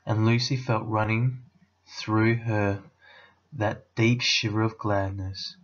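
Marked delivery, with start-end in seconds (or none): none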